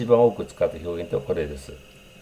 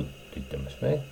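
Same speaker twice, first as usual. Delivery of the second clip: usual speaking voice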